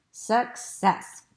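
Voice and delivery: ordinary delivery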